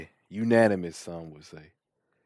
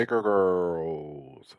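drawn-out, lightly put-on host voice; slow mock announcer echo; much slower than usual. Cohost echoes the announcer voice, slow and drawn out. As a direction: stretch it out like a TV promo. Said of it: "echoes in the voice".

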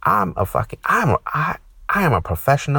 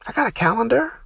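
incredulous retort; 10 semitones higher than usual. Acted. Retorting to the coworker.

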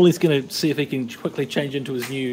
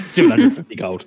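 louder, laughing parody of Brian; laughing mock price; 5 semitones higher, 5 dB louder, faster than usual.

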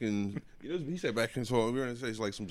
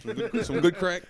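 higher, louder, drawn-out hype; hyped-up bro; 7 semitones higher, 6 dB louder, slower than usual. Acted. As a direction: sell it like a frat bro. Joking hype about crack.

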